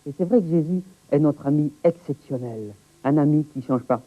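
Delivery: usual speaking voice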